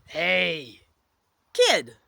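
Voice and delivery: put-on abrupt loud call to a kid. A narrator's reading. Stern man calls to the kid, calling out. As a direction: holler it to get attention. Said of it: "you hear someone call".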